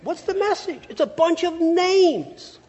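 high-pitched mock Italian accent; Italian accent, swelling loud and drawn out on the last phrase; strong Italian accent. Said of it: "In a really strong Italian accent".

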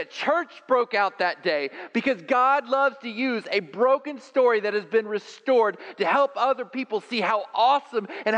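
very high, loud preaching climb; full-force preaching. Acted.

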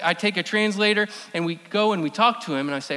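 usual speaking voice

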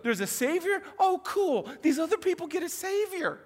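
high, hammed-up excitement that turns sarcastic; mock excited shepherds. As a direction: sound thrilled, then realize it's for others. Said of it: "they're like".

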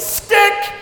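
sharp high yelled stick. Retold or imitated. Runner calling for the handoff, sharp yell. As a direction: call it out at the handoff. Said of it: "number two yells".

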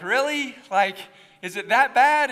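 high, loud mock disbelief; incredulous. Pastor reacting to stranger spotting his accent, incredulous. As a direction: act taken aback, voice jumping up.

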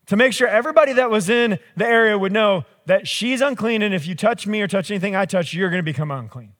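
usual speaking voice

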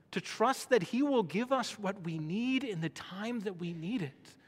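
ordinary talk, nothing marked

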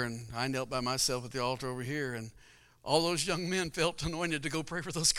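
ordinary talk

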